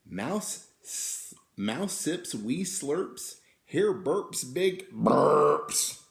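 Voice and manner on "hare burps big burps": slow and louder, bulging out the words; big burps. A narrator's reading. Reading about Hare's burps, big and slow.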